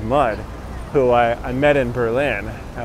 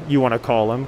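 usual speaking voice

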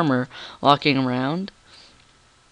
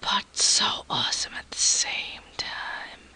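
whispering; 10 dB quieter than usual. Real, not acted. Whispered excitement about dissection.

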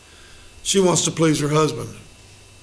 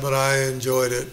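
ordinary talk, nothing marked

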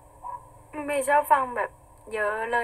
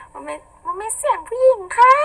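put-on much higher girly voice; 9 semitones higher than usual. Shown as a demonstration. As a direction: pitch up to show off a feminine voice.

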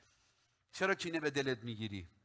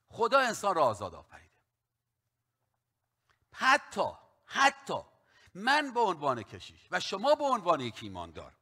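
full-force preaching; 6 semitones higher, 7 dB louder than usual. Acted. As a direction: preach it out to the church. Pastor preaching to congregation.

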